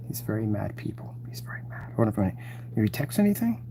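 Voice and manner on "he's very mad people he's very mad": hushed whisper about him; conspiratorial whisper. Real, not acted. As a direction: whisper it like a secret. Mocking the upset caller.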